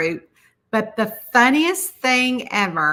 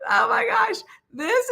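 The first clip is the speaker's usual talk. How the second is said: laughing; 12 semitones higher than usual. Real, not acted. Still laughing at photo.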